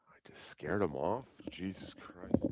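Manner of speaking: breathy hushed aside, barely voiced; a secret whispered to the viewers. Real, not acted. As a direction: whisper it so Jake can't hear. Hushed aside after scaring Jake.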